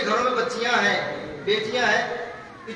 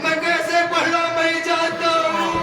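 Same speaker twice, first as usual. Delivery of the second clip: raised, chanted lament told to the mourners; mournful majlis recitation; 4 semitones higher than usual. Acted. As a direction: wail the story out as a grieving chant.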